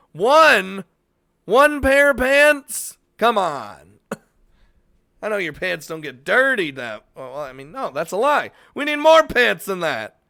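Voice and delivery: loud, high disbelief; can't believe it, one pair; incredulous. Real, not acted. Incredulous outburst.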